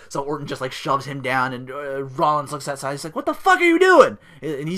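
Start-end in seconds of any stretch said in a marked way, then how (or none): 3.1-4.2 high, loud exasperated shout; furious mock outburst; angry yell. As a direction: yell at the useless security. Rollins yelling at his security.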